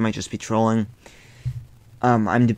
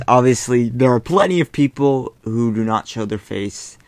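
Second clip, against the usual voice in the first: ordinary talk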